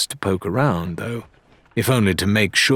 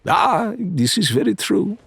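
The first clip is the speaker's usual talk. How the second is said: Faisal's smooth voice; 12 semitones higher than usual. A narrator's reading. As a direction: savour each word like a gracious threat. Faisal speaks.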